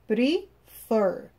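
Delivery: prefer with FER stronger, falling; stress on last syllable. Shown as a demonstration. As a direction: break it into pre-FER, weight on FER. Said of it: "ela é mais forte na última sílaba". (prefer com a última sílaba, FER, mais forte)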